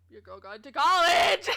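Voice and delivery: loud, proud whoop; big boastful holler; celebratory shout. Real, not acted. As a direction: shout it out to hype yourself up. Celebrates own college news to chat.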